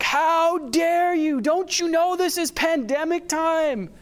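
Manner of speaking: high, slow, indignant mock scolding at the crowd; retold furious outburst. Preacher mimicking his anger at partygoers in the park, high and slow indignation. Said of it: "I was just furious".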